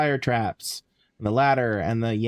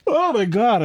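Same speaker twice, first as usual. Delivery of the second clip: startled shout; 11 semitones higher, 6 dB louder than usual. Real, not acted. Startled by a game scare.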